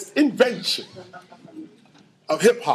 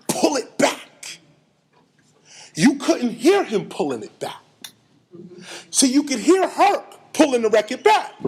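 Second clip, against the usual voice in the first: ordinary talk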